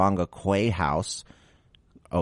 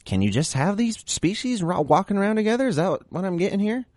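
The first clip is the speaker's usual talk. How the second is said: squeaky disbelieving question, much higher; high incredulous voice; 13 semitones higher than usual. Acted. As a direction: ask it in a high, shocked squeak.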